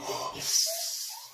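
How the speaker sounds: high unvoiced shocked gasp; a breathy rising gasp; sharp gasp. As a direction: suck in air in shock. News of ICU.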